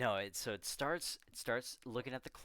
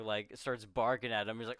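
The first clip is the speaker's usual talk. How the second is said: ordinary talk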